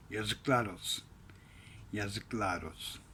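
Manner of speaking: bitter low mutter. Real, not acted. Bitter aside.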